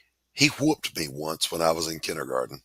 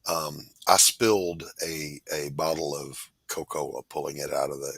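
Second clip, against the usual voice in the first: usual speaking voice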